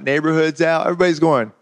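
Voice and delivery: ordinary delivery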